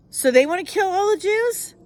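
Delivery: high, disbelieving question; incredulous. Real, not acted. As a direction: ask it in disbelief. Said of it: "incredulous question".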